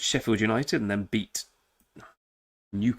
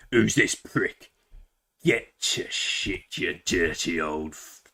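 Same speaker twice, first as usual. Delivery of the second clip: rough man; 4 semitones lower, much slower than usual. Retold or imitated. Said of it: "a man ... going".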